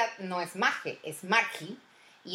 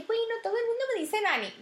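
much higher, fast light voice; quoting friend; 13 semitones higher, faster than usual. Retold or imitated. Mimicking her friend answering, high and quick. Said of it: "Y ella, que".

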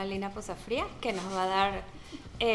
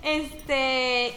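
laughing; 5 semitones higher, 4 dB louder, much slower than usual. Real, not acted. Laughs at her own chewing.

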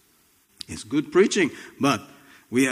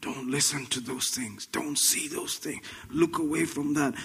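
hushed, breathless urging; urgent whisper, as if sharing a secret; 9 dB quieter than usual. Real, not acted. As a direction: drop to a whisper to draw them in.

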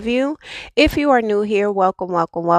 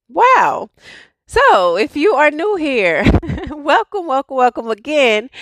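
excited; 7 semitones higher than usual. Real, not acted. Overjoyed, laughing.

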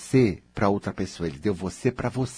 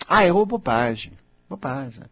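carefree inner voice; 3 semitones higher, 4 dB louder than usual. Pretend. A coach voicing a relaxed inner self, breezy. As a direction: toss off the mistake like it's nothing.

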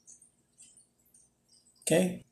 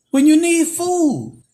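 high, loud, slow preacher's cry; preaching build; 9 semitones higher, 6 dB louder, slower than usual. Acted. Preacher launches story.

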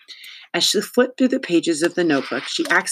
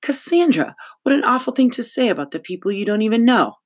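high, quick, indignant reproach; shocked scolding; 8 semitones higher than usual. Acted. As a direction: scold as a scandalized principal. Principal scolds Cass, shocked.

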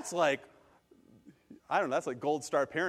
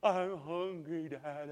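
put-on very slow, drawn-out little-kid whine; whining hungry child; 4 dB quieter, slower than usual. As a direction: imitate a hungry child pleading with Daddy. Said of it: "She says it in her own special way".

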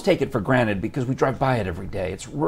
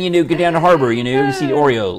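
louder mock-Baltimore patter; Baltimore accent; 3 semitones higher, 5 dB louder than usual. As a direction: launch back into a hammed-up Baltimore accent. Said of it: "That was Baltimore".

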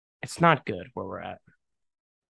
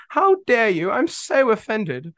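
high, mock-offended British old lady; hammed-up offence in a put-on old British lady voice; 10 semitones higher, 4 dB louder, slower than usual. Podcaster ridiculing people who say the phrase, mock British lady. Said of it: "I'm an old British lady".

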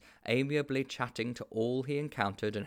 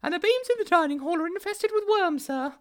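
much higher, anxious secretary voice; fretful school secretary; 8 dB louder than usual. A narrator's reading. The school secretary reports the boiler to the headmaster, fretful. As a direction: hurry up with bad news.

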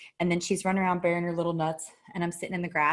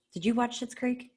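usual speaking voice

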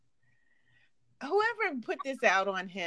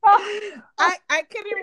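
laughing; 10 semitones higher, 8 dB louder, much slower than usual. Real, not acted. Laughs after playing dog sound.

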